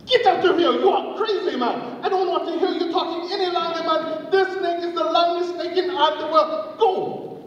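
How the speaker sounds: very high, indignant dismissal; staged quarrel. Acted. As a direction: send them off in a high, arguing voice.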